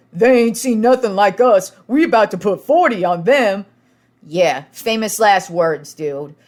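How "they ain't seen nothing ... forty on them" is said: louder and higher, swaggering brag; cocky boast. Retold or imitated. Falcons player bragging to teammate on sideline, cocky. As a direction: talk trash like it's already won. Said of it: "And the other guy goes".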